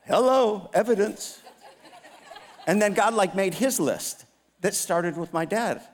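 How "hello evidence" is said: smug. Retold or imitated. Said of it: "I'm like".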